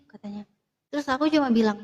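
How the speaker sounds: ordinary delivery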